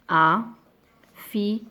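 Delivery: affirmative, lent et un peu plus fort; étirer « affirmative » pour bien insister; lentement syllabé. Shown as a demonstration. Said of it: "c'est une phrase". (« affirmative » prononcé lentement et appuyé)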